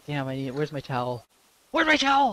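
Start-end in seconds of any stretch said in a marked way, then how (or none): 1.7-2.3 loud, high-pitched repeat of a demand; urgent yell. Real, not acted. Sweaty player calling for a towel, urgent yell. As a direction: yell for it in a panic.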